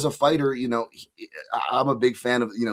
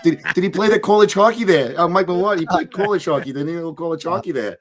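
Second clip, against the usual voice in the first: higher, louder hammed-up Boston accent, dropping by the end; 5 semitones higher, 5 dB louder than usual. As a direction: rave about the hockey player in a thick Boston accent. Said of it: "even I wanna go in a Boston accent".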